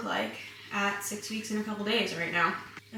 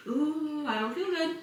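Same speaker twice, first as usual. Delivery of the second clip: queasy; 7 semitones higher, slower than usual. Retold or imitated. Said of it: "I get a little bit like".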